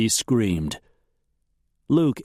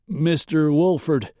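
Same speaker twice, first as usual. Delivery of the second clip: higher, hesitant sheriff voice; uneasy, questioning lawman; uncertain; 6 semitones higher, 3 dB louder than usual. A narrator's reading. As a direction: address him unsure of your next move. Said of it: "clearly uncertain what he should do".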